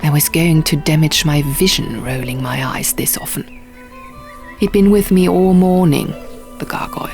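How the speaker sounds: plain reading voice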